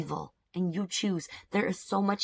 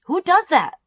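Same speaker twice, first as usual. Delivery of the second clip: much higher, loud indignant cry; outraged; 12 semitones higher, 11 dB louder than usual. Real, not acted. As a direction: burst out in disgust. Indignant outburst.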